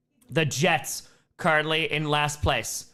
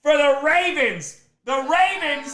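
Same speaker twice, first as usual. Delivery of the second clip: high, loud hype read; hype announcer; 12 semitones higher, 4 dB louder, slower than usual. Real, not acted. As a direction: shout it out like a hype man. Hyped donation read, high, loud.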